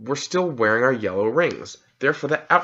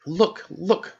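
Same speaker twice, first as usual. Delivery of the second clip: eager call, a little higher; excited cry; 7 semitones higher than usual. A narrator's reading. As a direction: point it out with boyish excitement. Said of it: "cried Diggory".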